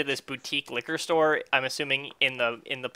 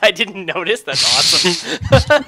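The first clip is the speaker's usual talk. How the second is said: high, loud and slow with glee; delighted; 5 semitones higher, 7 dB louder, slower than usual. Real, not acted. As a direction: gush with delight at the joke. Player delighted at the joke.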